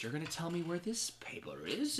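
unvoiced, threatening hiss of a whisper; menacing whisper. Villain whispering a threat to the captive boy.

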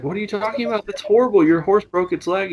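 high, mock-shocked neighbor voice. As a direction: play the neighbor who can't believe his luck. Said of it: "And he's like".

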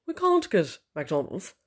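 put-on slow, drawn-out posh accent with long "can't"; mock posh husband. Husband rings to say no to McDonald's, mock posh voice. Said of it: "I just think he's awfully posh".

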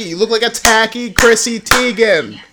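sassy scolding. Real, not acted. Telling the contestant on screen to drop the sash, sassy. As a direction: read her outfit for filth.

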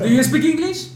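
high mock English accent; spanglish.

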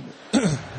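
short real cough; quick cough before going on; throat-clearing cough. Real, not acted. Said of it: "little time of coughing together".

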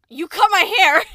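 loud high sudden realisation; excited shout. Real, not acted. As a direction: blurt it out excitedly. Sudden remembering exclamation.

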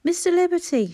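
high, loud call through the letterbox. A narrator's reading. Mother calling through a letterbox, loud call. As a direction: call his name to be heard inside. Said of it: "she called".

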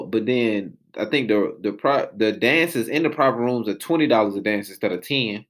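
usual speaking voice

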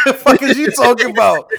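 loud and high, laughing through it; laughing retort. Real, not acted. Scoffing at the cancel question, laughing loud. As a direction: laugh it off in disbelief.

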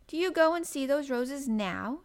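lightly played young girl, lifted pitch; inquisitive Mary. A narrator's reading. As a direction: ask hopefully as an eager child. Girl asks the gardener eagerly.

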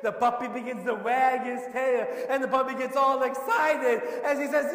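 loud, high, building preaching; preaching at full force. Acted. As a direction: drive the story home to the congregation.

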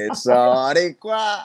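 high, drawn-out cheer of boricua; excited cheer. Real, not acted. Cheering on the Puerto Rican side with a long cry.

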